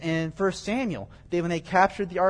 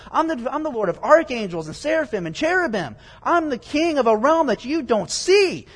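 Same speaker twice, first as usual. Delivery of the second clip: indignant, higher-pitched declaration of supreme rank; God; 6 semitones higher, 5 dB louder than usual. Acted. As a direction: pile up the titles with rising outrage into an incredulous question. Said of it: "He's saying".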